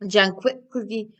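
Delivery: plain reading voice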